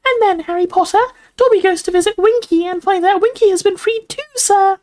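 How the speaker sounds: very high, gleeful elf squeak; delighted house elf. A narrator's reading. Dobby tells Harry his news, delighted squeak. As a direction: pipe the good news out delightedly. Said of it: "said Dobby delightedly".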